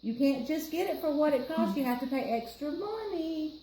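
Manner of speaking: usual speaking voice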